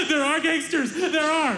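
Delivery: gasping, very high-pitched mock panic; hyperventilating panic. Hamza in the back seat after the fight, hyperventilating. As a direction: imitate a hyperventilating cousin squeaking in terror. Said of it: "Hamza is hyperventilating in the back seat".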